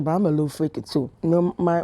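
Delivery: ordinary talk, nothing marked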